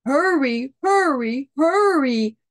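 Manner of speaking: a mild, rising urgent push; urging. Acted. Bear urging Mole to run, mildly rising urgency. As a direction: nudge the friend along, building each time. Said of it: "urged Bear".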